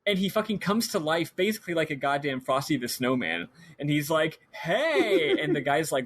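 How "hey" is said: high, drawn-out "hey" as a living doll; doll's mock greeting. Doll comes to life and greets the man, high mock hello. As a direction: pitch it up like a toy come alive.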